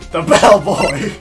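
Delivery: slow loud drawn-out reveal; dramatic reveal. Acted. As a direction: drag out the accusation.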